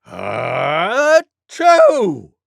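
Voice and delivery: long loud sneeze. Acted. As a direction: outdo the last one. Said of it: "much louder than that".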